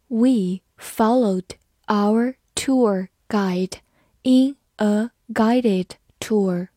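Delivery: very slow, each word separated by a pause; slow reading. Shown as a demonstration. English teacher models guide versus guided tour, slow reading. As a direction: read it word by word, slowly.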